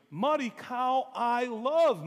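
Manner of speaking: high, loud squeal of delight, stretched out; excited child scream. Retold or imitated. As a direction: squeal it like a thrilled seven-year-old. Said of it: "Genevieve screams".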